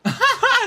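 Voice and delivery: sudden high loud laugh; a real laugh bursting out; burst of laughter. Real, not acted. Laughing at the gamer gossip about old news, high burst of laughter.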